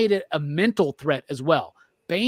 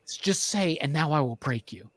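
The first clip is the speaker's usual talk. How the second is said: low, soft urging at the screen; quietly willing a character to say it; hushed pleading; 4 semitones lower, 6 dB quieter than usual. Retold or imitated. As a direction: plead under your breath.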